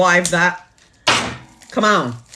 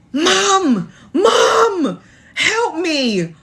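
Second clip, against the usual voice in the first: high, desperate cry for help, much higher and louder; screaming for mom; 10 semitones higher, 3 dB louder, much slower than usual. Retold or imitated. Stuck in sleep paralysis, calling her mother in terror. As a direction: cry out for your mother in panic. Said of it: "in my unconscious mind screaming".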